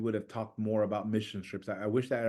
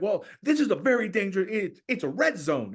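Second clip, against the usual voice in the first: warning officer; 8 semitones higher, 3 dB louder than usual. Retold or imitated. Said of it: "He's like".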